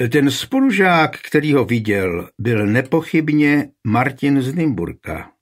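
usual speaking voice

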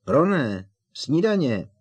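loud high call, breakfast rising. Acted. Calling Ron to breakfast.

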